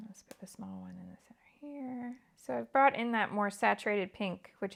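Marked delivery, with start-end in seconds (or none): 0.1-2.2 whispered self-talk, placing pieces. Real, not acted. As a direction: say it under your breath, just for you. Repeats the line while placing pieces.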